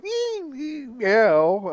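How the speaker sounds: squeaky high cat cries then a loud meow; cat noises. Retold or imitated. Imitating his cat's squeaks and meow for viewers. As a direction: mimic a cat squeaking and mewing. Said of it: "they go".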